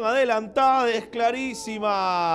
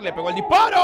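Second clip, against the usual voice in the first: high, loud shout at the shot; excited shout; 11 semitones higher, 7 dB louder, much faster than usual. Real, not acted. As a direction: yell as the shot flies. Shot commentary.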